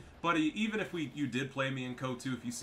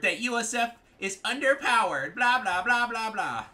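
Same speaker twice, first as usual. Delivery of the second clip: high, loud mocking voice; mock whiny opponent; 11 semitones higher, 10 dB louder than usual. Player dismissing a rival's balance complaint, high mocking blah-blah.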